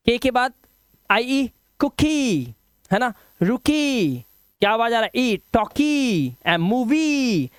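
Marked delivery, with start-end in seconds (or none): 1.8-2.4 कुकी with a long, held "ee" at the end; long final vowel. Shown as a demonstration. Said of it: "long vowel sound देना है". 3.4-4.2 रुकी with the last "ee" held long; long final vowel. Shown as a demonstration. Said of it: "long vowel sound देना है". 5.2-7.5 टॉकी and मूवी with very long final "ee"; long EE sound. Shown as a demonstration. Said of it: "long vowel sound देना है".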